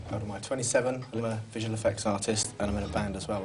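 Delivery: usual speaking voice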